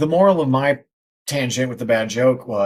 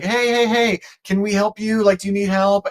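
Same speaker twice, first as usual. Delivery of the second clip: calling down from helicopter; 7 semitones higher than usual. Retold or imitated.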